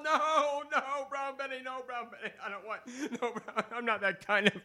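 high, fading pleas with laughter; beg, losing breath to laughter; panicked pleading. Acted. Narrator begging the women in mock panic, high.

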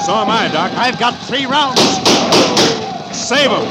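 high, loud calls over gunfire; shouting in a gunfight; battle shouts. Acted. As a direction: yell above the fight. Gunfight on the stagecoach.

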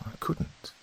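whispered to herself. A narrator's reading. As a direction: breathe it out, resolved. Narrator's unvoiced self-resolve.

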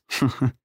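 brief chuckle at his own joke; small smug laugh; short laugh. A narrator's reading. James laughing at his own vampire joke, short chuckle. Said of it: "James laughed".

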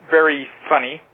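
ordinary talk

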